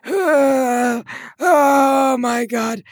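high, loud, drawn-out strained groan; straining effort. Pretend. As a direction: push the words out with the last of your strength.

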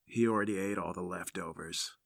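low, very soft voice; older woman's aside. Acted. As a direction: drop right down after yelling, for the visitor only. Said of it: "turns back to you and she goes".

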